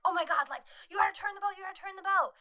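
high, loud mock alarm; panicked urging. Guy urges driver to turn boat, mock panic.

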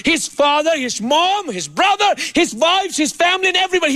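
high, driving sermon cadence; full-force preaching. Acted. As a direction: preach it out to the congregation.

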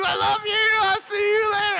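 high, gushing farewell; giddy goodbye. Retold or imitated. Student hugging everyone on the way out, giddy goodbye. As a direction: act out a blissed-out goodbye, pitched high.

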